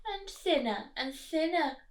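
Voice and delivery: lively rhythmic read-aloud; picture-book rhyme reading; storybook narration. Acted. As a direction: read it bouncing along for little ones. Reading rhyming story to children.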